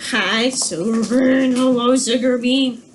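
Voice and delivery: slow, drawn-out cooing; sing-song to dog. Real, not acted. Teacher slowly croons to her dog during the recording. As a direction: croon the dog's name slowly.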